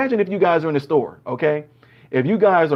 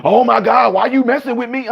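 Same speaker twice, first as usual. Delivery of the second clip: mock defensive kid; 5 semitones higher, 3 dB louder than usual. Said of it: "a police officer say".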